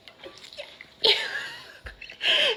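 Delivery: high-pitched imitated wail; exaggerated high cry; mock wail. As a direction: mimic sister's shrieky meltdown. Imitating sister's outburst when followed.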